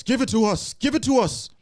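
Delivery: eerie ghost rising to a wail; ghost voice building. Acted. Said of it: "creepy ghost whisperer voice".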